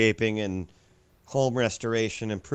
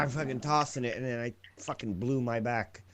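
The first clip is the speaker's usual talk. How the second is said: ordinary talk, nothing marked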